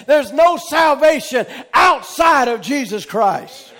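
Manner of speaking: loud, driving sermon cadence; full-force preaching. Acted. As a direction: proclaim it to the congregation, hammering each repeated phrase.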